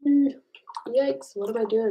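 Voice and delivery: normal talking voice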